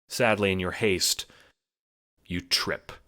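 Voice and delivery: usual speaking voice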